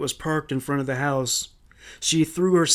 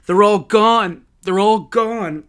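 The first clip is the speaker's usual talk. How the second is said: higher, louder, distraught wail; anguished raised voice; terrified cry; 6 semitones higher, 7 dB louder, slower than usual. A narrator's reading. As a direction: cry out in horror at the loss. Mother runs out in terror.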